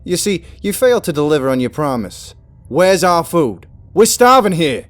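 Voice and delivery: loud, high furious demands; ranting yell for food; angry rant. Acted. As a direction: shout the demands at him. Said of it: "Andrew continued his rant".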